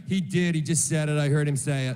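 low-pitched imitation of scandalised passengers; gossiping onlookers. Murmuring onlookers gossip about the insult.

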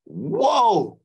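high, loud drawn-out exclamation; amazed. Retold or imitated. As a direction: replay being blown away, voice leaping up. Acting out amazement at her singing.

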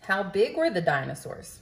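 plain reading voice

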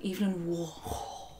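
slow posh pronunciation; lightly posh name. Shown as a demonstration. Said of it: "should be said in a posh voice".

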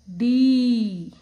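डी loud, with a long ee; long-vowel demonstration. Shown as a demonstration. Teacher joins letter and matra, long loud डी. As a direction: call out a stretched डी. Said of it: "बड़ी की मात्रा".

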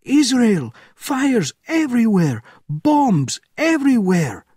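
frantic high yell down the line; loud, drawn-out hysterical cries; screaming officer. A narrator's reading. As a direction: scream each report in panic. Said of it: "he screamed".

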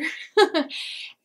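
short breathy giggle; airy laugh. Real, not acted. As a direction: laugh it off lightly. Laughs after Hoosier line.

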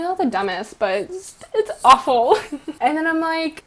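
normal talking voice

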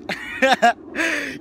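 a short, real laugh breaking through; bright burst of laughter; amused laugh. Real, not acted. Laughing at baby talk.

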